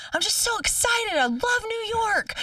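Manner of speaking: put-on high, light little-boy voice, a bit slowed; son's excited voice. Son telling mom in the car, high excited little-kid voice. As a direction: play her seven-year-old, giddy about the city. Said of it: "He was like".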